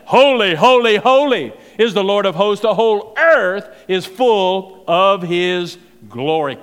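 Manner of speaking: loud ringing proclamation, slowing at the end; proclaimed cry. Acted. Seraphim crying to one another, loud proclamation. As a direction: cry it out like angels before the throne. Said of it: "they're crying out".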